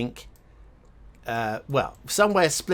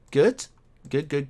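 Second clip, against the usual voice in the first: pleased exclamation; 5 dB louder than usual. Real, not acted. Player delighted by the lifebuoy sign, loud cheer. Pleased at emergency-only ring.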